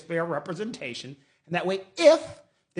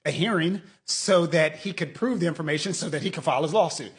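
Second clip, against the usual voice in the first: ordinary talk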